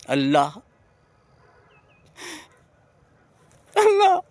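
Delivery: crying out the name, overcome, high and loud; emotional cry rising to a high peak; emotional outcry. Real, not acted. Devotee overcome by love of God, emotional outcry. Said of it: "I'm getting emotional".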